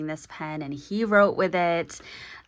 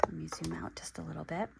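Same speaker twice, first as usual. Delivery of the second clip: fast unvoiced whisper to herself; whispered aside; 10 dB quieter, much faster than usual. Real, not acted. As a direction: mutter it under the breath, quickly. Quiet aside while filming.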